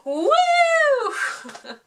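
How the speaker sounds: high whooping cheer; cheering woo. Acted. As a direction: whoop it out like a party. New year cheer.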